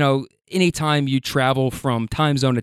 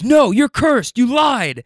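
retold angry shout; 9 semitones higher, 4 dB louder, slower than usual. Stranger insists he's cursed.

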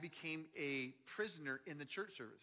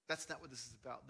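normal talking voice

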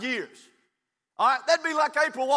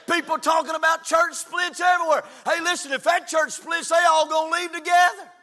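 raised, urgent pulpit voice; emphatic revival preacher; impassioned preaching; 6 semitones higher, 6 dB louder than usual. Real, not acted. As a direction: press the point on the congregation. Preaches to congregation.

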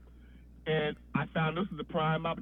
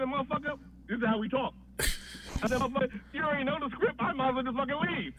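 higher-pitched indignant protest, played for the story; retold protest in a raised pitch; mock indignant; 8 semitones higher than usual. Writer protesting to Cosby, mock indignant. Retold argument with his boss.

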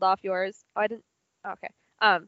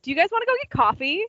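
higher, fast, eager question; eager invite; 8 semitones higher, faster than usual. Retold or imitated. Rachel asking the class for coffee, higher and fast. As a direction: toss out the invite brightly and quickly. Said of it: "was like".

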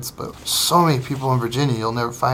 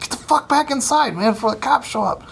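retold shouted order; 9 semitones higher than usual. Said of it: "I'm screaming at".

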